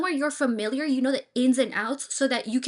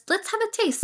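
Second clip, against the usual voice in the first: bright, much higher, keen; eager tasting; 10 semitones higher than usual. Shown as a demonstration. Adviser modelling an eager guest trying new food. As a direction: sound ready to dig in. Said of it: "You can say".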